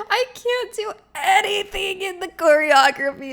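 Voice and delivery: high, loud comic wail; loud mock-tearful complaint; wailing despair. Real, not acted. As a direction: cry out helplessly, hammed-up. Mock despair about dance class.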